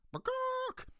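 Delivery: very high bagawk cluck; chicken squawk. Acted. Chicken sound.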